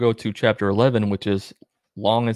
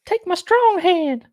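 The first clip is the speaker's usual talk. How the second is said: very high mock angel voice; a high silly voice mocking the angel's odd order; 4 dB louder, slower than usual. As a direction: lampoon the angel in a high squeaky voice. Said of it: "It's like".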